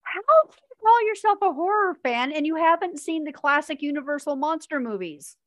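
indignant disbelief; mock outrage. Real, not acted. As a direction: call out the so-called horror fan. Scandalised at horror fan.

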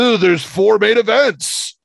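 slow, high mocking drawl; mock the people saying it; mocking quote. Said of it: "And none of this".

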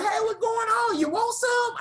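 very high, hollering hillbilly greeting; country brother. Retold or imitated. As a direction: yell a welcome off the porch. Voices the moonshine-drinking brother.

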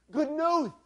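high, loud cry of excitement; Bernie's excited shout. A narrator's reading. Preacher voicing Bernie running up to the boys, excited shout. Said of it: "shouting at the top of his lungs, his voice cracking with excitement".

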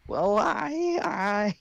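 high, stammering nervous laugh; flustered, lost for words; nervous girl. Acted. Ruby nervous.

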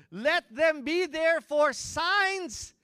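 very slow, high, loud declamation; booming scripture proclamation. Acted. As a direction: stretch each word out at full volume. Preacher proclaims the verse again.

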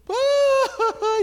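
loud, high mock bawl; hammed-up ugly cry; imitated crying wail. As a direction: wail out a long exaggerated sob. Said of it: "y lloraba, lloraba".

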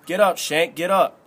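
forceful narrated bullying command; rough order. A narrator's reading. Albie hauls Thomas to his feet.

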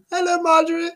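high put-on hello; sing-song greeting. Greets his silent co-host in a falsetto voice.

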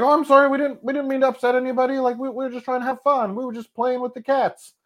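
high, fast, sheepish apologising voice; mock apology. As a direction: play it as a squeaky, overeager sorry. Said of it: "I was like".

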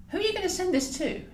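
curious question. Acted. Roz questions Eleanor.

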